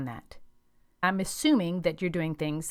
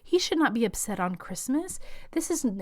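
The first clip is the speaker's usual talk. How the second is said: higher-pitched mock guilt-tripping; reproachful grandparent; 7 semitones higher than usual. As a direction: chime in accusingly as a meddling grandparent. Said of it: "will chime in and say".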